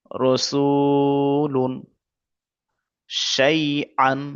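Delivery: রসুলুন and শাইআন with very long held vowels; drawn out. Shown as a demonstration. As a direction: stretch each word slowly. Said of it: "এখানটা এরকম পড়তে হবে". (রসুলুন, শাইআন টেনে টেনে)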